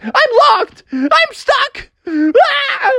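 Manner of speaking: very high, hammed-up wail; crying panic. Froggy is locked in the bathroom, wailing in mock panic. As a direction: squeal it like a trapped, panicking kid. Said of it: "started to cry and panic".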